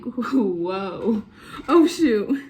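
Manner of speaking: sudden alarmed exclamation; startled. Retold or imitated. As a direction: caught off guard, loud. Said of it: "and I'm like".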